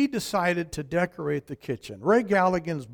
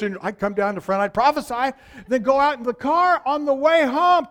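ordinary delivery

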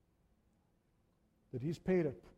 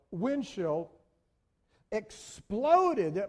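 usual speaking voice

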